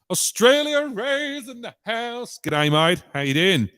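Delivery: a bad, hammed-up Australian twang; terrible Australian accent. Vlogger greeting an Australian viewer, in a terrible Australian accent. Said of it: "apart from my terrible Australian, um, accent".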